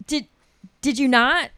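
ordinary talk, nothing marked

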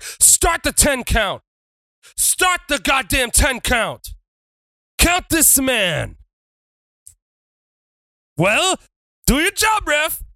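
high, slow furious wrestler yell; hammed-up heel wrestler screaming at the ref; shouting at the ref. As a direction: bellow at the referee to count.

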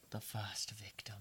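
hushed, eerie echo; breathy dramatic whisper; spooky whisper. Acted. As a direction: whisper it like a ghost story. Echoes it in a whisper.

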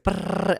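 high loud brrr drum noise; mock drum roll. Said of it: "it's like".

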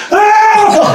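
high yelp of shock; shocked yell. Retold or imitated. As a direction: cry out as the van hits the door. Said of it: "And I was like".

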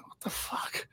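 breathy disbelieving whisper under his breath; stunned whisper. Retold or imitated. Host recalling his silent reaction to Shane's money remark, whispered. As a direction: mouth it in shock, barely voiced. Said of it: "And I'm like".

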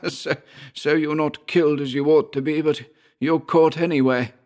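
high-pitched gloating enemy, laugh still in the voice; snarling, mocking Georg. A narrator's reading. Georg to his pinned enemy, snarling mockery. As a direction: crow at a trapped rival in a high character voice. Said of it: "snarling laugh".

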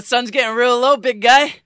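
cowboy quote done for laughs; mock gruff line. Riffing on the movie line.

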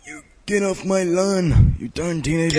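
a mock cranky old man shouting; grumpy old man. Cranky old man yelling at teenagers on his lawn.

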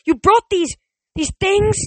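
high, loud horrified accusation; screaming. A narrator's reading. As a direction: keep screaming, aghast. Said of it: "Nico screamed".

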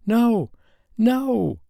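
high, loud sobbing cry of no. A narrator's reading. Woken from a nightmare, sobbing high and loud. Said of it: "she sobbed over and over".

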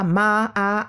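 MA, AH, the second syllable leaned on; stress on ah. Shown as a demonstration. Speech therapist showing where the stress falls. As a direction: put the weight on ah. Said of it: "the stress goes on the ah".

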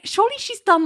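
high, disbelieving squeak; incredulous. Retold or imitated. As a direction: sound like you can't believe it. Incredulous reaction to TV scene.

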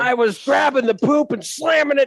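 high put-on storyteller voice; hammed-up voice. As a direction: push it up high, playing the gross part. Acting out toilet story.

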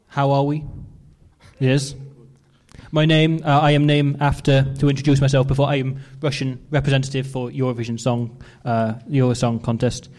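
lightly put-on Russian accent, broken English; a hint of a Russian Eurovision contestant, halting. Introducing himself to the audience as a Russian singer, light Russian accent. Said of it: "how to do a Russian accent now".

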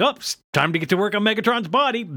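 quick, high-pitched scientist voice; Sumdac voice. Retold or imitated. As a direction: rattle it off high and fast. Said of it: "Sumdac walks in and is like".

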